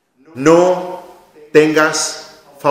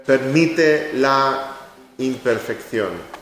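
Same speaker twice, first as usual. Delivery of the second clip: slow emphatic repeat; much slower than usual. Shown as a demonstration. Preacher slowly repeating his advice to parents. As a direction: drag it out so it lands.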